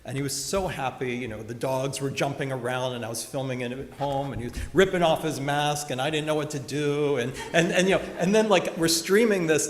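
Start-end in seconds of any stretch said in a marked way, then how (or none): none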